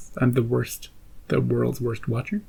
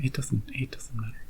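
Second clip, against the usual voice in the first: dismissive whisper; 9 dB quieter than usual. Real, not acted. To viewers who don't comment, a light whisper. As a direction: drop to a whisper, no big deal.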